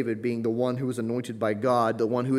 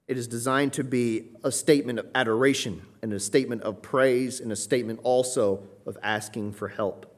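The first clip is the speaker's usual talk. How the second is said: ordinary talk, nothing marked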